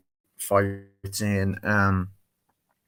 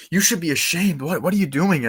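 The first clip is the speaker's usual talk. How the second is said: higher, indignant telling-off; mock scolding; 8 semitones higher, 4 dB louder than usual. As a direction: act out an outraged reprimand. Acts Diana scolding the men.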